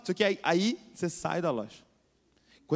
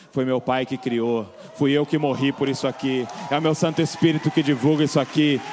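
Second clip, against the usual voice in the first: building louder, fervent; rising proclamation; 6 dB louder than usual. Acted. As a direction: declare it with growing force. Jesus afirmando o valor, crescendo. (proclamação firme que vai crescendo)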